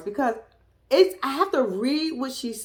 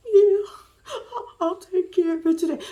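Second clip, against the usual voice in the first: higher, meek promise on the phone; lightly voiced girlfriend placating him; the bride's voice; 8 semitones higher than usual. Bride answering boyfriend, voiced.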